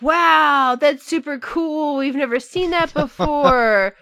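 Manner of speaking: drawn-out, impressed wow; mock amazement. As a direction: gush as an easily impressed crowd. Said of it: "everyone would be like".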